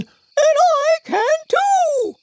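very high, slow squeaky voice; a tiny squeaky piece of furniture; talking dresser. Acted. Dresser chimes in very high and slow.

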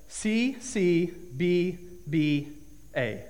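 slow, deliberate reading. Retold or imitated. Reading grades off report card.

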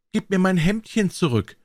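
high, pleading maiden's voice; pleading enchanted princess. A narrator's reading. Princess asks drummer for her shirt back, high and pleading.

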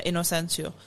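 normal talking voice

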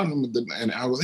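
usual speaking voice

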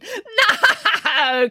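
very high cackle stretched through the word; cackling. A narrator's reading. Little man rejects the Queen's second guess, cackling. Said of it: "cackled the man".

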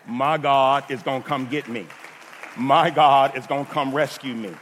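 loud, emphatic preacher's declaration; full-force preaching. Real, not acted. Preacher declaring God will rescue him, full-force preaching. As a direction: proclaim it to the congregation, punching key words. Preaches climax after film story.

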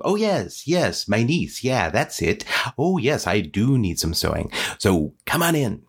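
put-on old storybook witch welcoming a niece; swooping, sing-song pitch; Baba Yaga. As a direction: coax the visitor in, wheedling. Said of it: "and Baba Yaga goes".

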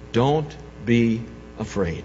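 a hushed, slow don't be afraid; soothing words to frightened women; gentle reassurance. Acted. Angel's words softly quoted.

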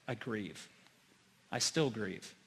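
confessing a hurt he still carries; real grief. Real, not acted. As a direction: let it sink into sorrow. Confessing old sin, voice drops.

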